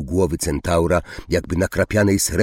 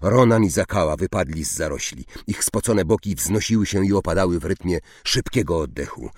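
plain reading voice

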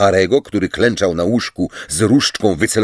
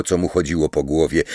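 plain reading voice

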